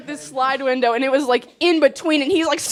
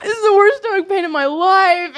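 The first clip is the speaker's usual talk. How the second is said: raised, loud wail of pain; mock tearful complaint; 6 semitones higher, 5 dB louder than usual. Imitating friend almost in tears with cramps, tearful. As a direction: moan it for comic effect. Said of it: "He's, like, almost in tears".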